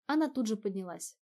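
ordinary delivery

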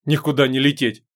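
ordinary delivery

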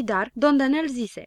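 ordinary delivery, nothing marked

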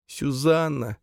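high plaintive moan; grieving moan. A narrator's reading. Camille mourning her dead friend, grieving moan. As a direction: moan the name high, in grief. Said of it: "простонала Камила".

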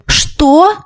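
high, startled cry; astonished exclamation. A narrator's reading. Everyone reacts to the new house news, astonished exclamation. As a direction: exclaim it in stunned surprise. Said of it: "в один голос воскликнули все присутствующие".

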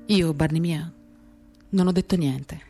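ordinary talk, nothing marked

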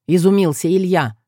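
ordinary delivery

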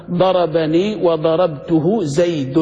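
very slow, every syllable drawn out; slow model reading. Shown as a demonstration. Teacher shows students how to pronounce the sentence, slow and clear. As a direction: recite it like a grammar example on the board. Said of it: "كيف بتنطقها".

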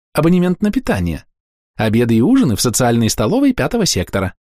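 high chirp, lightly voiced; chirpy young clerk. A narrator's reading. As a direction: keep the sweet clerk voice. Clerk's lines continue.

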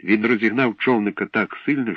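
ordinary delivery, nothing marked

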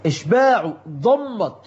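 normal talking voice

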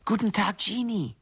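very high, singsong hello; mock-sweet greeting. Greeting Jeannie in taunting notes, high singsong.

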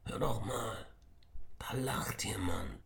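slow, hushed whisper; listen closely and whisper. Acted. Emir hears laughter down in the cellar, whispering. Sneaking through dark cellar.

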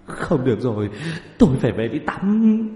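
high, loud, distressed whine; upset whine rising to a loud cry; hoarse-mouthed wail. A narrator's reading. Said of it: "miệng khàn khàn".

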